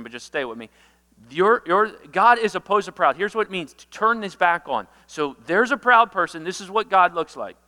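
ordinary delivery

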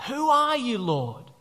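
high, loud start falling away sharply; acted alarm, pitch leaping up then sinking; startled Saul. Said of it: "Saul has a little bit of a heart attack".

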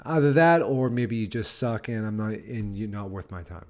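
ordinary delivery

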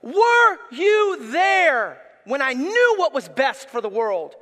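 slow, loud shouted divine challenge; God rebuking Job, slow and shouted; God speaking to Job. Retold or imitated. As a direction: boom out God's question to Job. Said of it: "he says to Job".

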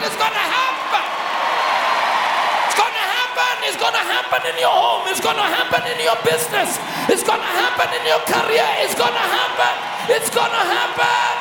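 loud, high, building sermon; preaching. Acted. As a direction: rouse the congregation, shouting. Preaching at full force.

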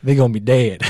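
drawled Southern twang on the punchline; a hint of a Southern accent. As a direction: play it like a Southern good ol' boy, lightly. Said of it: "Nice accent".

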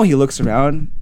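plain reading voice

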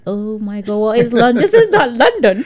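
rising from hushed shock to loud, high laughing outrage; disbelief building to laughter. Retold or imitated. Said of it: "I'm like".

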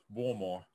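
"Baltimore" mushed to "Wawlmore"; muffled two-syllable "Baltimore"; jawbreaker in mouth. Retold or imitated. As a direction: say "Baltimore" round a huge candy, no T. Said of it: "like they got a jawbreaker in their mouth".

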